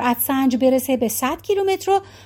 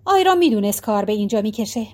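ordinary delivery, nothing marked

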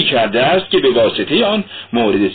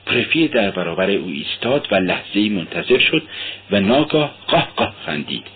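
ordinary delivery, nothing marked

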